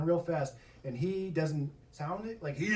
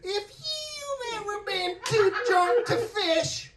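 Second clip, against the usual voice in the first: mock ad voice; 11 semitones higher, 4 dB louder, slower than usual. Mock ad jingle read.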